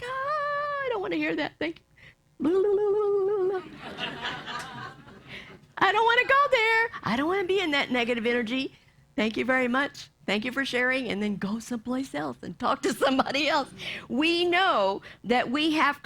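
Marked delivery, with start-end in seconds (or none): 0.0-11.1 high, mocking blah blah brush-off; dismissive brush-off. Someone brushing off a negative friend with mock politeness. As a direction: wave the negativity away breezily. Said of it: "We're like".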